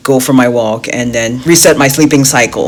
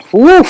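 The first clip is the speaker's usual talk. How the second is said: high happy tipsy woo; tipsy whoop; 14 semitones higher, 7 dB louder than usual. Real, not acted. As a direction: let out a loud joyful whoop. Feeling the pina colada.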